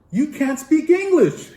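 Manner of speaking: can't believe it, half laughing half exclaiming; laughing disbelief. Retold or imitated. Professor to student who traveled without English, astonished laughter.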